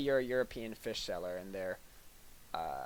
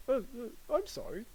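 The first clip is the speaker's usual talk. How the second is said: higher, halting "I'm sorry" that means nothing; insincere apology; 10 semitones higher than usual. Shown as a demonstration. Comic demonstrates a dismissive apology, hollow and insincere. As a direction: stammer out a sorry you don't mean. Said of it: "Sort of in that tone".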